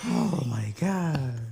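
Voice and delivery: very slow, drawn-out disbelief; stunned reaction. Real, not acted. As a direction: stretch it out, softly aghast. Reacts to the panties reveal.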